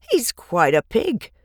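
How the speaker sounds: a hint of a farmhand; lightly voiced hired man; Lurvy. A narrator's reading. Farmhand Lurvy praising the pig, lightly voiced. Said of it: "said Lurvy".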